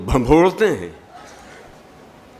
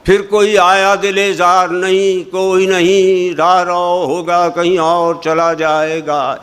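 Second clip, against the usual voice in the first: much louder and higher, slow, every word hammered; declaimed wrongly; 6 semitones higher, 6 dB louder than usual. Retold or imitated. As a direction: bellow the lines like a stage declamation. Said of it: "उसे भी इसी तरह पढ़ा जाता है".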